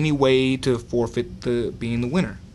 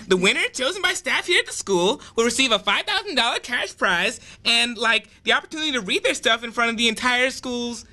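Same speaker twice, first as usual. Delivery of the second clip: put-on higher, airy valley-girl voice; ditzy dialect; 7 semitones higher than usual. A narrator's reading. Blonde girl host announcing the contest, ditzy. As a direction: play a bubbly ditzy teen host. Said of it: "spoke in her ditzy dialect".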